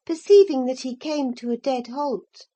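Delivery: usual speaking voice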